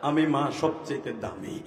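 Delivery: mother's proud claim, gaining force; building declaration. Acted. Mother's acted quote, repeated with growing force.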